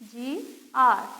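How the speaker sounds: letters spelled slowly. Shown as a demonstration. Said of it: "यहाँ पर मैं लेती हूँ जीआर".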